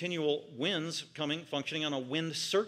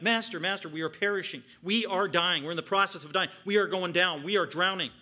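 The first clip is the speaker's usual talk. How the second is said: louder, higher, pressing every word; emphatic cry; 3 semitones higher, 6 dB louder than usual. Retold or imitated. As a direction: shout it as men about to drown. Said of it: "that's emphatic".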